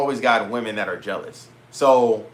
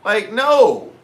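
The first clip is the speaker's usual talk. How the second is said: emphatic no; 10 semitones higher, 8 dB louder than usual. Real, not acted. Rejecting the lame opener.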